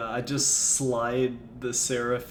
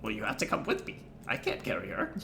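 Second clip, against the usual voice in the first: goblin voice; 6 semitones lower, faster than usual. Acted. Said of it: "Goblin voices".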